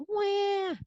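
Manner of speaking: very high wailing cry; baby wail. Acted.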